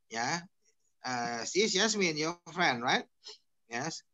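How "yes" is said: ordinary delivery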